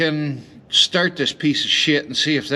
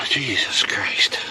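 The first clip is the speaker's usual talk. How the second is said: long weary sigh then whispered slowly; tired sigh; 6 semitones lower, slower than usual. Real, not acted. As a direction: sigh it out, worn out. Exhausted after climbing under truck.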